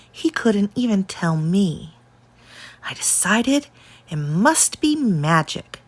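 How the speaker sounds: usual speaking voice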